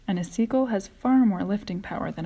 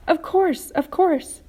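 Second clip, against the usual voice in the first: eager; 10 semitones higher, 7 dB louder, slower than usual. A narrator's reading. Eager reassurance from the bugs.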